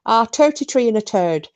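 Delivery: imitating the uncle's Irish accent; Irish accent, teeth clenched. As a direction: say it like the uncle with his jaw shut. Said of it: "he'd be there going".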